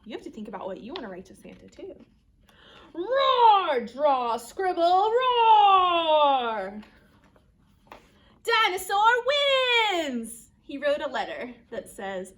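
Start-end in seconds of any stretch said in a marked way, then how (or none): 2.9-6.8 loud roars between action words; big, high, drawn-out final roar; dinosaur roaring. Acted. Dinosaur roars while writing letter. 8.4-10.5 loud, high, long-drawn victory cry; big slow cheer; triumphant announcement. A narrator's reading.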